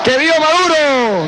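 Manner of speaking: rousing rally shout; booming balcony speech. Real, not acted. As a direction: call it out to the crowd. Rally chant of vivas.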